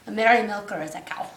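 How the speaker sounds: ordinary delivery, nothing marked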